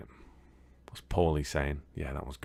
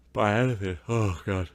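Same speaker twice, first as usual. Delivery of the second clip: mid-yawn, words stretched out; 4 semitones higher than usual. Real, not acted. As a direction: talk through a yawn. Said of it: "I was yawning".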